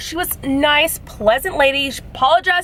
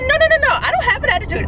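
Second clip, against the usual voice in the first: heated denial; 14 semitones higher, faster than usual. Retold or imitated. Caller denying attitude to the agent, heated high protest. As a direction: shut the accusation down fast. Retold quarrel with phone agent.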